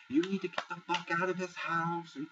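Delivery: imitating Lauren telling her off, high-pitched; mock screaming rant. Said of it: "standing on this bench screaming at Alex's head".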